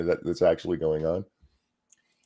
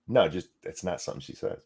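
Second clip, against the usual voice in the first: ordinary talk, nothing marked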